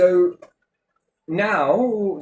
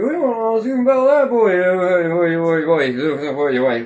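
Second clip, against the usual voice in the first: higher, louder rustic farmer voice; how the farmer talks; 5 semitones higher, 5 dB louder, slower than usual. Retold or imitated. The farmer talking to the lorry driver's boy, rustic. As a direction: ham up the local farmer's chatter. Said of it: "That's how they talk".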